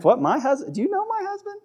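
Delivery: high, incredulous squeak on my husband; mock disbelief. Wife doubting her husband, high mocking disbelief. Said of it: "You're like".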